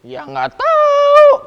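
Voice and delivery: very high, loud, slow drawn-out shrug; mock the son with a lazy high whine; sing-song don't-care from the farm owner; owner's dismissive reply. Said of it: "kata si pemiliknya".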